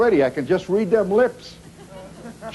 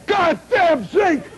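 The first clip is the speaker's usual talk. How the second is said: slow, high mock shout of a furious dad; father cursing the zinc, hammed-up shout; angry shout; 10 semitones higher, much slower than usual. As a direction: bellow it like the old man catching his shoe. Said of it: "my old man would come in".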